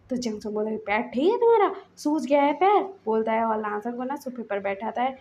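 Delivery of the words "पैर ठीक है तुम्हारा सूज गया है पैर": loud, higher incredulous anger; angry disbelief. Acted. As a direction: challenge his claim angrily. Chanchal angry about swollen foot. (गुस्से में अविश्वास)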